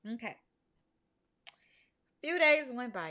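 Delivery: ordinary talk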